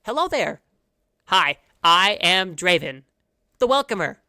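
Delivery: frog greeter. Acted. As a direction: greet the travellers like an eager little frog. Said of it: "a young frog man walks up to you and goes".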